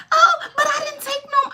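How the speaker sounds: high, whiny mock denial; mother's denial. Daughter mimics mother's denial, high whiny voice. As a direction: whine it like a guilty old mother. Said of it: "mother retold denial".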